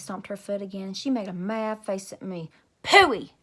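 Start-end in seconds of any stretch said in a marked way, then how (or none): 2.8-3.3 loud, high sulky huff; mad pout. Acted. Grace sulking at Junie B, loud high pout.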